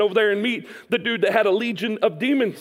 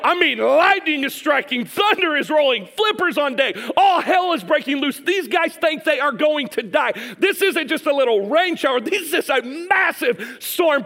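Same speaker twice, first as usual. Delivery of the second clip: high, driven preaching; urgent storm telling; 4 semitones higher than usual. Acted. Preacher describing a massive storm to listeners, high urgent delivery. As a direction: build the danger, hammering each image.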